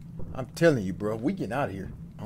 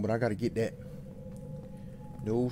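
normal talking voice

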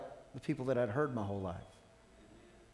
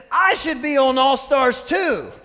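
put-on high, loud, drawn-out kid's declaration; slow, high-pitched imitation of an insistent daughter; imitating his daughter; 10 semitones higher, 12 dB louder than usual. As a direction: belt it out like a child making a claim. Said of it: "She said".

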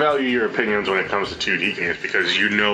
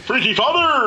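announcer voice; 4 dB louder than usual. Pretend. Announcer voice named after.

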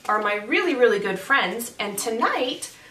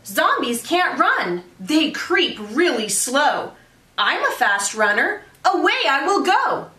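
excited yell; 6 semitones higher than usual. Acted. Jack yells his escape plan, high and excited. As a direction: shout it like you just figured it out. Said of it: "he yelled".

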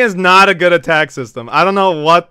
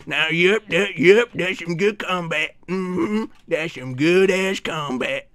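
slurred mock cowboy mumble; 3 dB quieter, slower than usual. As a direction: drawl approval like a satisfied cartoon cowboy. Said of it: "I'll do the cowboy voice".

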